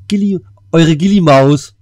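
high, loud, drawn-out pet name; exaggerated cutesy farewell call; hammed-up sign-off. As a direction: sing out the nickname slowly and playfully.

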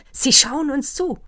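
cried out high and loud; exclaimed. Acted. Doctor crying out to the counts, high and loud. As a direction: blurt it out in sudden wonder. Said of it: "rief der Doktor".

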